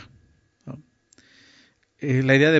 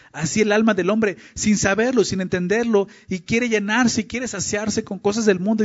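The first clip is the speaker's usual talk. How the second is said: higher, rising preacher's fervor; impassioned preaching; 5 semitones higher than usual. Acted. Preacher urging listeners about the soul's thirst, impassioned. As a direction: preach it with urgency.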